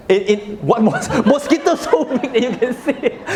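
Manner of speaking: laughing. Real, not acted. Speaker mocks the audience's mosquito guess, laughing. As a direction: laugh through the incredulous question.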